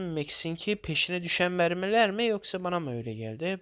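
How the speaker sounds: ordinary talk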